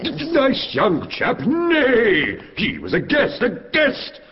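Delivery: high, indignant outrage; outraged. Acted. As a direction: splutter with indignation. Outraged husband.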